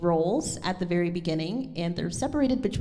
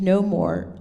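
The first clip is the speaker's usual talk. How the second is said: loud, firm stress on "no more"; forceful "no more", louder than the words around it; strongly emphasized; 8 dB louder than usual. Shown as a demonstration. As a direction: hit "no more" hard. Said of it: "are very strongly emphasized".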